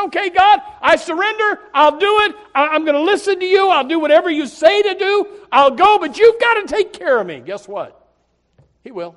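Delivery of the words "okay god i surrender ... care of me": high, loud pleading cry to God; a preacher acting out a loud, high surrender prayer; loud earnest surrender. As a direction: shout the surrender up to heaven.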